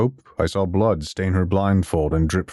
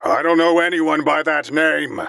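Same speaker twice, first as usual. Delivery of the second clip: higher, louder angry shout; angry yell; 9 semitones higher, 4 dB louder than usual. A narrator's reading. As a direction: yell it back in anger. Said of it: "Amon angrily yelled back".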